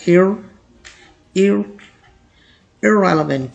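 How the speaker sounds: "ir-" alone twice, then whole irrelevant as "ir-REL-uh-vent"; softened vowels. Shown as a demonstration. Teacher drills learners on the start, careful pronunciation. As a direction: build irrelevant from a short "ir-". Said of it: "बिल्कुल ही मध्यम पढ़ा जाता है".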